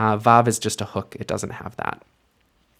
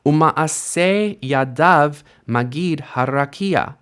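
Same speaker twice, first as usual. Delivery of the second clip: slow reading; 4 semitones higher, slower than usual. Shown as a demonstration. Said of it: "read it slowly to you".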